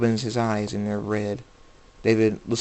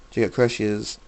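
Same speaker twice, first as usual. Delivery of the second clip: plain reading voice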